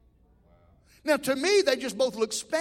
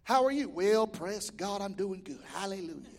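Christianese talk; 5 semitones lower, 6 dB quieter than usual. Retold or imitated. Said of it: "We have our own language".

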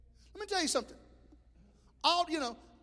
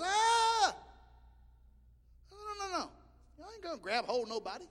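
mock panic; 10 semitones higher than usual. Imitating panicked helpers.

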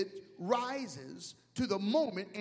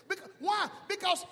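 higher, louder preacher's shout; full-force preaching; 9 semitones higher, 3 dB louder than usual. Acted. Pastor preaching to the congregation, shouting. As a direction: preach it hard to the crowd.

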